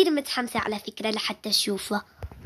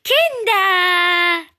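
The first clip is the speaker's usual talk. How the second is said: dramatic reveal; 5 semitones higher, 4 dB louder, much slower than usual. Acted. Dramatic reveal of unwanted guest.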